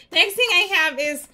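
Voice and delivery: giggling, high-pitched apology; laughing. Real, not acted. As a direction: laugh it off while talking. Laughing off the hiccups.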